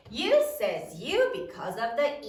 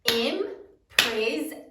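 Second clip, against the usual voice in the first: drawn out slowly syllable by syllable; slow clapping-out of syllables; slow syllable demo; 3 dB louder, much slower than usual. Shown as a demonstration. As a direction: stretch imprison slowly to count its beats. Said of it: "How many syllables are there in imprison?".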